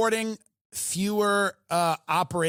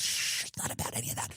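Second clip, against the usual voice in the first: hushed whisper; 8 semitones lower, 9 dB quieter, faster than usual. Retold or imitated. Hushing Lindsey in the quote.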